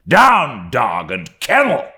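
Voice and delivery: sudden high, loud command; scornful exclamation. A narrator's reading. Ahab ordering his mate below, scornful shout. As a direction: burst out as if ordering off a dog. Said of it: "unforeseen concluding exclamation".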